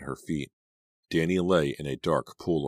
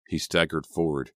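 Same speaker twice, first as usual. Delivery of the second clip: usual speaking voice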